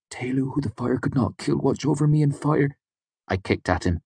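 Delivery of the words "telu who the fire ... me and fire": desperate prayer, higher pitched; fervent praying. A narrator's reading. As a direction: murmur a frantic prayer to the god. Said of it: "Martin still lay praying on the bank".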